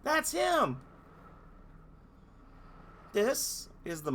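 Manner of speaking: high, squeaky little fairy; piping and indignant like a tiny fairy. Acted. Fairies complaining to the heroes about the dolphin.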